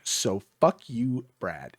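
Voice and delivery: slow, low and soft, as if writing it out; deliberate deadpan. Retold or imitated. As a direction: imitate someone dictating to himself while signing. Author repeats it while signing the book.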